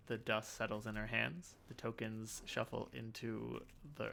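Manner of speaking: hushed in-character storytelling; cryptic game character. Acted. Game master voices character, narrates.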